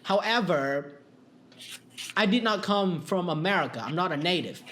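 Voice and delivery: usual speaking voice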